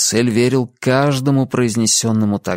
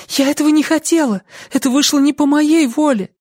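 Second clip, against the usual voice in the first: very high, pleading voice; defensive young woman. A narrator's reading. Lucy denying it was her will, pleading. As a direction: protest innocence in a high voice.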